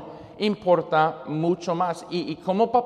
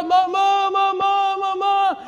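put-on high wailing kid calling mom; a small boy wailing for his mother; crying child; 13 semitones higher than usual. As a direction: cry out like a hurt child. Crying nephew calls his mother.

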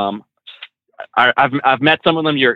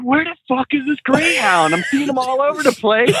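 exasperated; 10 semitones higher than usual. Retold or imitated. Retelling his frustrated wait for bus.

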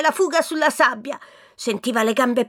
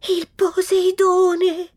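terrified cry; 6 semitones higher, much slower than usual. Acted. Tom terrified at ghost ship.

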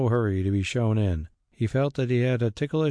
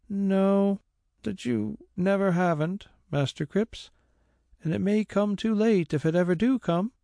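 much higher woman's voice, eager; a maid assuring her master; servant Mary; 11 semitones higher than usual. A narrator's reading. Mary assures the squire, high eager voice.

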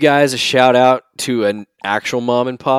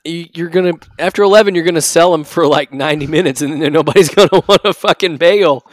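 excited; 5 semitones higher, 5 dB louder than usual. Real, not acted. Excited riff on selling out.

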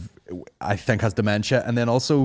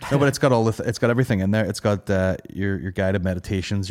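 ordinary delivery

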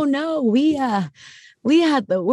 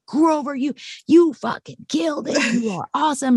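excited praise; 6 semitones higher than usual. Retold or imitated. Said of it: "I'm like".

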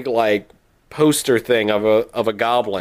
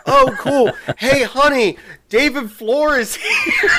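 excited fan; 11 semitones higher, slower than usual. Retold or imitated. Retelling a fan calling her husband over, high laughing excited shout. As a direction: shout across the room, thrilled and giggling. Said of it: "And she's like".

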